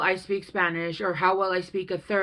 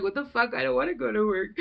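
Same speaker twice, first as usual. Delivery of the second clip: imitating crying girl; 5 semitones higher, faster than usual. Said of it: "She's crying 'cause she has to go to work".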